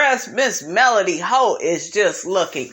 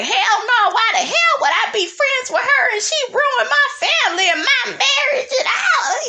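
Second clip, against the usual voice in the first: much higher screeching imitation, half laughing; cat-like screech. Melody furious at Miss Wanda, imitated cat-like screech. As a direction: yowl it like an angry cat. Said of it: "Melody was sounding like a damn cat".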